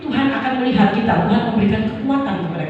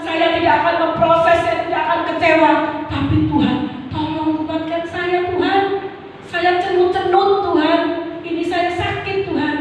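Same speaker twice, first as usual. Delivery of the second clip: surrendering prayer; 7 semitones higher than usual. Retold or imitated. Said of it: "begitu juga saya pada saat itu".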